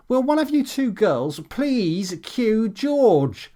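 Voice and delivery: a director losing patience, yelling; yelled. A narrator's reading. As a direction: shout across the set at the crew. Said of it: "yelled Steve".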